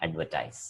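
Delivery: advertise with a "tize" ending, dropping off softly; model pronunciation. Shown as a demonstration. Teacher closes the noun-verb contrast, careful. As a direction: let advertise fall away on "tize". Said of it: "two different kinds of pronunciation".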